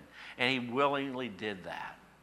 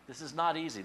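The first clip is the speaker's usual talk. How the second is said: ordinary delivery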